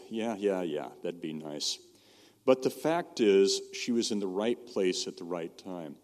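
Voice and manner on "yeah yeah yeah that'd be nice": low, soft half-hearted agreement; reluctant, unconvinced. Retold or imitated. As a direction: imitate someone brushing off a promise, voice dropping away. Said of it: "her attitude was".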